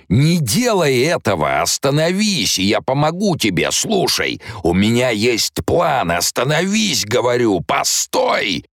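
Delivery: a tyrant panicking as he loses control; Baron Harkonnen shouting. Acted. The baron inside Alia screaming at her to stop. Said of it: "Голос барона продолжал вопить своё".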